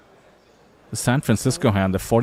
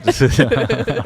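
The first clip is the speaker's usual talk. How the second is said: embarrassed laugh; 3 semitones higher, 3 dB louder than usual. Real, not acted. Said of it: "All right. I got forced into that one".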